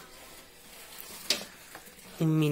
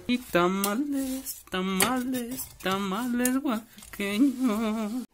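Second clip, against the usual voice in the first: street vendor cry; 7 semitones higher, much slower than usual. Pretend. Keeps vendor call.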